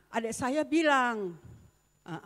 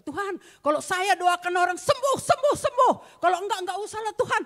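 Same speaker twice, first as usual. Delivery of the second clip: desperate prayer; 10 semitones higher, 9 dB louder than usual. Retold or imitated. Retold desperate plea to God.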